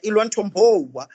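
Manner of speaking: hammed-up American accent, quoting Maya Angelou; mock American accent. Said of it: "in that American accent, like".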